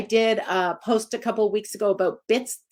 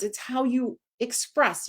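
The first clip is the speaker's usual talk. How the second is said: ordinary delivery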